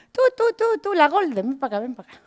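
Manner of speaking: high sing-song call of a scornful teacher; imitating a teacher beckoning; mocking teacher call. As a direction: call her over like a sneering teacher. Teacher calls her over mockingly.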